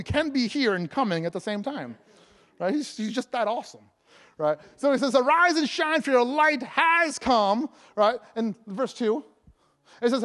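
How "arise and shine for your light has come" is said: raised proclamation with "has" stretched out; stress on HAS. Real, not acted. Preacher re-reading Isaiah sixty, HAS lengthened. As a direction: lift the voice and draw out "has". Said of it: "focus right there on the "has" part".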